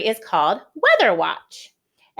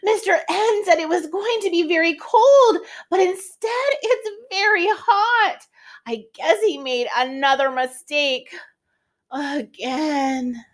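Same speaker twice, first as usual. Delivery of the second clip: much higher, exasperated storybook lady; Miss W voice; 9 semitones higher than usual. Acted. Miss W on the way to the salon, higher mildly exasperated voice. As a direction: complain about the hot day, lightly fed up. Reads Miss W's lines.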